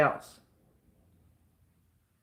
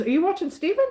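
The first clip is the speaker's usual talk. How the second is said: higher, playful ribbing; teasing question; 9 semitones higher, much faster than usual. Retold or imitated. Husband asking if she watches Steven, teasing. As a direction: rib her gently. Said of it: "And he says".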